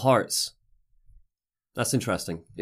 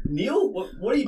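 surprised; 13 semitones higher than usual. Retold or imitated. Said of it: "I'm like".

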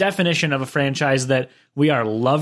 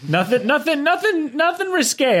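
high squeaky protest; 11 semitones higher than usual. Pretend. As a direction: pitch climbing very high, mock innocent. Jokey disclaimer, voice climbing.

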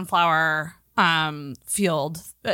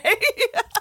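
high giggle; 13 semitones higher than usual. Real, not acted. Laughing at her own joke.

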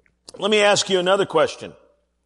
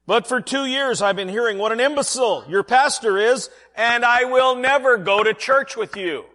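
higher and louder, firm no; firm refusal; 7 semitones higher, 5 dB louder than usual. Acted. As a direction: refuse her flat out, loudly. Harriet refusing firmly.

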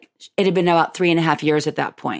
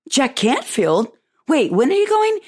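much higher, sharp surprised question; shocked realisation; 7 semitones higher than usual. Retold or imitated. Hearing the friend is going with Jack, much higher astonished. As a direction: jump up in disbelief.

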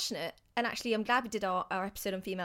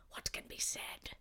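slow, unvoiced dramatic whisper; 11 dB quieter than usual. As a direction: whisper the last line. Ending poem dramatically.

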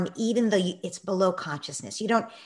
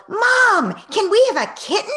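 put-on high, loud child's yell; 10 dB louder than usual. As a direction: burst in yelling like an excited kid. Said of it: "dropped her jacket on the floor and yelled".

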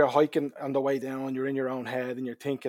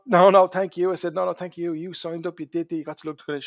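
retold insistence; 6 semitones higher, 10 dB louder than usual. Insisting on thanking the hiker back.

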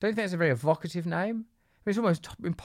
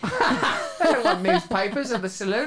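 much higher, laughing indignation; playful high-pitched protest; giddy mock outrage; 10 semitones higher than usual. Real, not acted. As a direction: squeal it out, half laughing.

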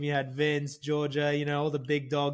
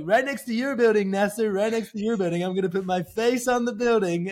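higher, louder Trump bragging; Donald Trump impression; 4 semitones higher, 4 dB louder than usual. [name] as Trump telling the prince where the tower goes, brash Trump brag. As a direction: brag like Trump about his face on a building. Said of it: "I was like".

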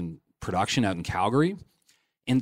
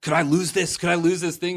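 higher-pitched anxious pleading questions; retold panic; 7 semitones higher than usual. Actor calling the agent about losing the job, panicked. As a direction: re-enact a panicked call, voice raised in pitch. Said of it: "I'm like, I'm panicking".

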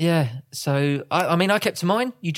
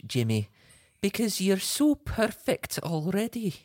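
bad put-on Scottish accent; gushing Scottish wife, badly impersonated; Claire. Husband plays his wife praising him, bad Scottish accent. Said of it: "that is the worst Scottish accent".

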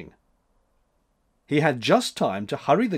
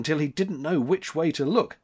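plain reading voice

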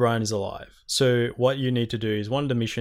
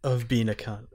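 getting angry; 7 semitones higher, 5 dB louder, slower than usual. Real, not acted. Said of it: "I'm getting really angry about this".

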